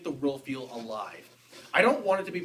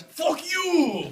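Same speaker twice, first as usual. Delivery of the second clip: higher, louder, slowed mock shout; mock angry outburst; 13 semitones higher, 6 dB louder than usual. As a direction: blow up in fake outrage.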